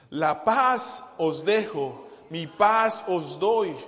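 loud, fervent preaching; preaching at full force. Acted. Pastor loudly preaching peace to the church. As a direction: proclaim it to the congregation.

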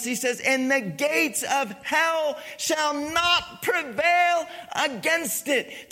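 loud, high, building sermon delivery; full-force preaching. Real, not acted. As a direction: proclaim it to the whole congregation with mounting fervor.

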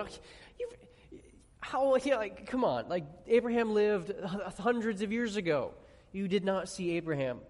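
acted-out scoffing, starting high and stumbling; mocking. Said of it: "they're kind of mocking him".

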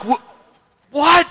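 stammered "Wh-, what?" leaping up very high; incredulous what. Retold or imitated. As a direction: act out stunned disbelief. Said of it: "And I'm like".

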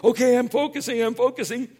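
high, loud, desperate self-push; straining to force calm; strained forcing. Retold or imitated. Pastor mocking himself forcing focus, high and strained. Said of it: "and like".

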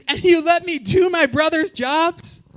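high, slow disbelieving question; high-pitched stunned son; shocked son. Retold or imitated. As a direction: gasp it out in disbelief. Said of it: "And he says".

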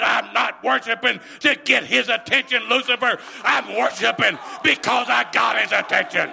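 loud preacher's call, pressing hard; rousing sermon cry; full-force preaching. Acted. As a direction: drive it home to the church. Preacher answers the devil loudly.

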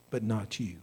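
low, soft voice of doubt; discouraging inner voice. Acted. Doubting voice speaking to the believer, low and discouraging. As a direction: drop low and quiet to discourage. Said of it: "the voice that says".

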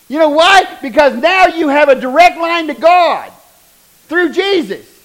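high, loud sermon build; full-force preaching. Real, not acted. Preacher telling the congregation about Jesus, full-force preaching. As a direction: preach it out to the room.

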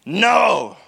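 emphatic no. Acted. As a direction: fling out a firm denial. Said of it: "Peter declared emphatically".

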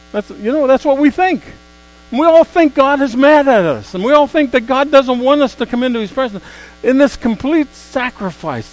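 loud, high preacher's climb; preaching at full force. Acted. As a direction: build the sermon to a shout.

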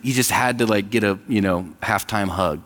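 normal talking voice

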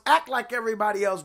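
usual speaking voice

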